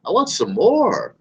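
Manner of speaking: high, amazed voice, slowing at the end; mock amazed lead. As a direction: gape at it like it's the coolest thing. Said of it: "You're like".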